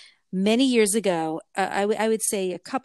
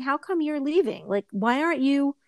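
higher-pitched, hurt pleading; retold upset protest in a raised pitch; upset child; 6 semitones higher than usual. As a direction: plead like a kid being left out. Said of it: "I was so upset".

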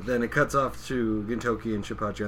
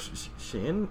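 stammering recognition; 8 semitones higher, 4 dB quieter than usual. Retold or imitated. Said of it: "the guy with the pompadour goes".

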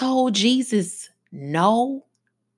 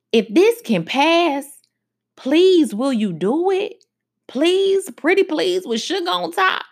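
pleading Jesus; 8 semitones higher than usual. Acted.

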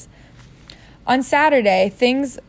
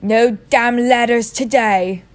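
cheerful uncle; 2 semitones higher, 5 dB louder, slower than usual. A narrator's reading.

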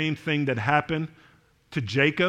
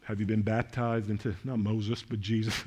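much softer and lower, intimate; hushed appeal; 5 semitones lower, 6 dB quieter than usual. Acted. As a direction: lean in and ask it quietly. Soft pastoral appeal.

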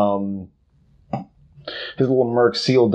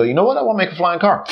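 imitated impulsive inventor; 8 semitones higher, much faster than usual. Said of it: "a wild hair up his butt and go".

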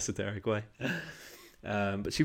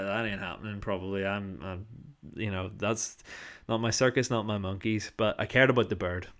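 ordinary talk

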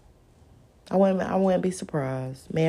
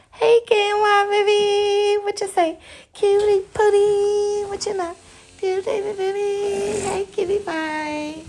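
put-on high, sing-song cooing to a baby; baby talk; slower than usual. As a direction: coo up high at the little one.

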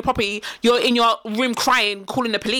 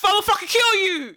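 slow, higher mock threat; mock menacing; 8 semitones higher, slower than usual. Podcaster imitating an American homeowner threatening an intruder, mock menacing. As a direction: ham up the trigger-happy homeowner. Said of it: "they're like".

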